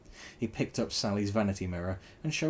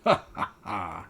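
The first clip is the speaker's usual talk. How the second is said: gloating laugh; 4 dB louder, slower than usual. A narrator's reading. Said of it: "it said".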